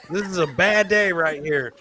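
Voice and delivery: ordinary delivery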